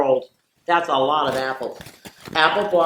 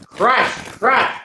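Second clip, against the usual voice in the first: crushing sound; 6 semitones higher, 3 dB louder than usual. A narrator's reading. Sound words in picture book.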